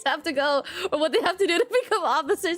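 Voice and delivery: helpless high-pitched laughter through the words; real giggling fit mid-rant; cracking up. Real, not acted. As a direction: laugh so hard the sentence climbs and breaks. Cracks up ranting at police.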